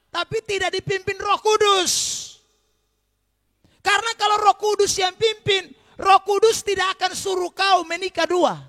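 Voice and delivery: full-voiced pulpit shout; forceful preaching shout. Real, not acted. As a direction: thunder the correction at the congregation.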